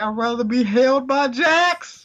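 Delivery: very high, squealed falsetto; mock baby plea. Baby begging for someone else, falsetto mock plea. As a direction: drag it out in a squeaky mock wail. Voices the desperate baby's plea.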